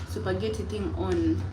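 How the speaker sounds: normal talking voice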